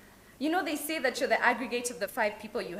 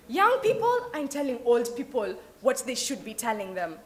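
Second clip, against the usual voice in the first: usual speaking voice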